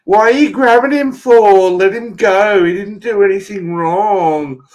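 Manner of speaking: high, loud bystander protesting an arrest; mock screaming. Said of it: "all they do is they scream".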